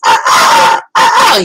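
loud, very high squawking like a seagull; seagull noises. Retold or imitated. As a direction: screech it out like a panicking gull. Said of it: "He was making seagull noises".

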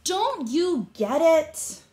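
high, drawn-out scold; exasperated mole rats. A narrator's reading.

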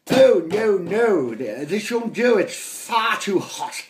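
high, loud, affronted lady; hammed-up snooty complaint; fussy posh mother. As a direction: complain the food is far too hot. Mother complains about food.

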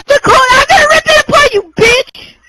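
high-pitched furious shout; angry outburst. Real, not acted. Player raging at friend over the game, high angry shout.

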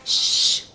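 sharp unvoiced "shh"; hissed shushing; urgent shush. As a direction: hush him firmly. Hushing a patient who speaks openly.

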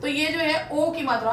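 normal talking voice

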